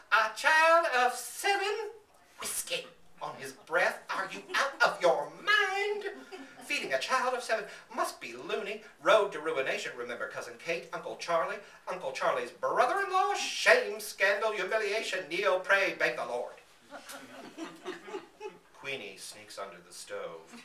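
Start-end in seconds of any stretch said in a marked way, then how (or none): 0.1-16.5 much higher and louder, outraged relatives; wrathful scolding. Acted. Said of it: "in a wrathful tune". 16.9-18.7 a high breathy chuckle; amused laugh. Real, not acted. Narrator laughs after angry voices.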